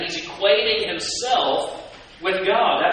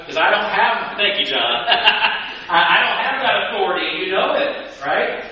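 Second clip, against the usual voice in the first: laughing; 5 semitones higher, 6 dB louder than usual. Real, not acted. Laughing at the idea.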